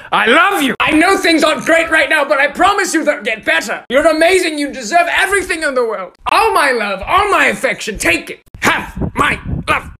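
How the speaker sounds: high full-force shouting; aggressive affection. Acted. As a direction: scream your love at them like an order. Aggressively yelling affection at listener.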